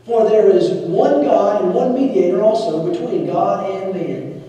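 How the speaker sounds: preacher reading the passage aloud with force; scripture reading. Acted. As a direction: proclaim the verses to the whole church.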